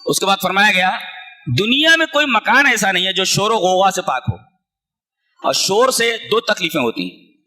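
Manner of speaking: usual speaking voice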